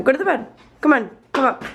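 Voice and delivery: high, bright call to a pet; calling the dog. Real, not acted. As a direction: call him up cheerfully.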